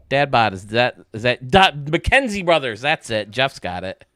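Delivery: usual speaking voice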